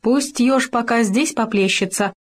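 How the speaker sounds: sharp fox command. A narrator's reading. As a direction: order Khoma about. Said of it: "прикрикнула она". (лиса покрикивает, выше)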